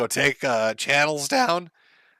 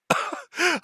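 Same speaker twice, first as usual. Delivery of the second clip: high laugh; 14 semitones higher, 4 dB quieter than usual. Real, not acted. Laughing at his own joke.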